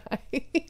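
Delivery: soft, high, choked little laugh; teary laugh. Real, not acted. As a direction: let a wet laugh escape. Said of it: "I'm gonna cry".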